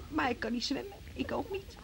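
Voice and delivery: breathy ashamed whisper. Acted. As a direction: barely voice the confession. Hushed admission nobody could swim. (fluisterend, beschaamd)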